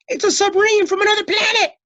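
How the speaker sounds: high, loud panicked shriek; shrieking coach. A narrator's reading. Coach seeing the monster, shrieking. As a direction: shriek in terror. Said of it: "shrieked the coach".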